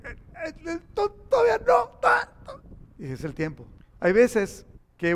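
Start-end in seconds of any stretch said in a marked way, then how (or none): 0.0-2.6 high stammering squeak; flustered stammer. Retold or imitated. Preacher acting his panic in a high stammer. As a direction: choke on the words in panic.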